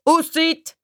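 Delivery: high referee-style call. A narrator's reading. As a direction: call a timeout across the gym. PE teacher calls a timeout.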